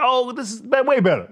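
high, loud, amazed "oh, this is way better"; delighted squeal of discovery; mock delighted discovery. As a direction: play the teen thrilled by a new discovery. Retold excited realization, laughing.

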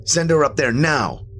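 sharp high demand. A narrator's reading. Tony demanding the man send his wife to heaven. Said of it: "I demanded".

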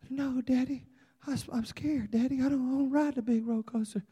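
put-on small timid child, voice lifted and hushed; scared little boy. Little Andrew begging Dad off the big coaster, scared.

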